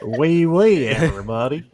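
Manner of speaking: mock French greeting. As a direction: ham up a French hello with a laugh. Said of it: "Let me give it a try".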